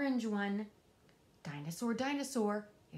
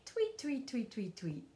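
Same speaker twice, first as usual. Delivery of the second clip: bird tweets. Acted. Bird behind the door.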